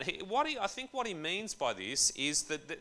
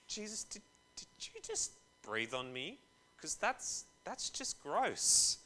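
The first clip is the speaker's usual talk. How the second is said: higher, softer, a lightly voiced grossed-out disciple; mock disgusted disciple; 6 semitones higher, 4 dB quieter than usual. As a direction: recoil from the breath, half-joking. Disciple reacting to being breathed on.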